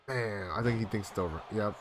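ordinary talk, nothing marked

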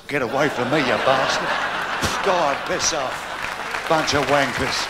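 low, slow drawn-out curses; angry crowd. Acted.

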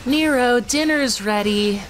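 put-on high sweet girlfriend voice; Kyrie voice. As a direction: gently call him to dinner. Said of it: "Kyrie speaking in skit".

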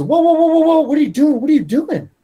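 high, loud squawk of a panicked busybody; alarmed interruption. Acted. Teammate stopping him salting his food, high and loud alarm. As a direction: burst in with mock alarm, voice shot way up.

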